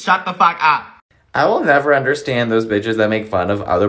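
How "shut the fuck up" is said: ordinary delivery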